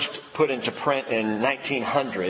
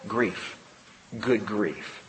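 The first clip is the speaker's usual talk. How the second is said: hushed answer; 7 semitones lower, 5 dB quieter, much slower than usual. Real, not acted. Answering his own question.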